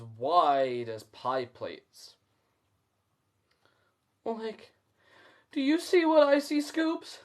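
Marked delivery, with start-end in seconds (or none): 4.2-7.2 high, amazed Shaggy voice; Shaggy in disbelief. A narrator's reading. Shaggy asking Scooby in high disbelief. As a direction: gape at what you see. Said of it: "Shaggy gulps in disbelief".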